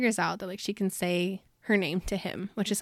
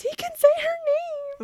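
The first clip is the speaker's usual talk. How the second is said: very high, drawn-out excited squeal; all-caps shriek; 4 dB louder, slower than usual. Retold or imitated. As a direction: squeal it slowly like writing in capitals. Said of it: "wrote in all caps".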